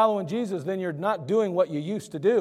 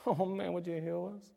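hopeful plea; 12 dB quieter than usual. Acted. Blind men softly asking Jesus to heal them. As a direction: ask humbly for healing. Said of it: "blind men answer softly".

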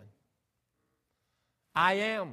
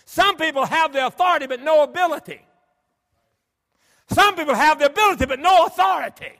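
much higher and louder, pounding each phrase; full-force preaching; 11 semitones higher, 15 dB louder than usual. Acted. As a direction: hammer the point home from the pulpit.

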